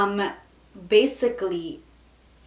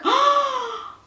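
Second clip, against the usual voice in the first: sharp high gasp; a sudden, high intake of breath; shocked gasp; 5 dB louder than usual. Real, not acted. Stunned as her phone lands in her hand, sharp gasp.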